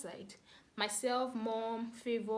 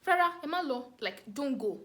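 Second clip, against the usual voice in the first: thief's warning; 4 semitones higher, 6 dB louder than usual. Retold or imitated. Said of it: "and then he said".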